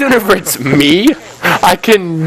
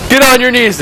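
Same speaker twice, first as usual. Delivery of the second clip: mild mock yell; 9 semitones higher, faster than usual. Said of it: "One of them yelled".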